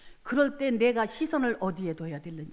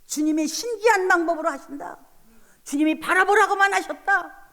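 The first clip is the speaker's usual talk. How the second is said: preaching at full force; 8 semitones higher, 6 dB louder than usual. Acted. A pastor telling the congregation she kept holding to God, preaching hard. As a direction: proclaim each line like a trumpet blast. Preaching her faith aloud.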